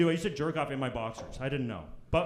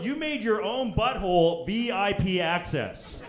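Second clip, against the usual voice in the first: ordinary delivery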